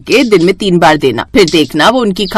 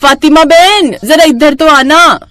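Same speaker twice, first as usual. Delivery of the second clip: higher and louder, drawn-out call; 7 semitones higher, 4 dB louder, slower than usual. Acted. Neighbour calling Fatima through the kitchen window, loud drawn-out call. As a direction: call her over from another window, slow and loud. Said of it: "Gurpreet की आवाज आती है".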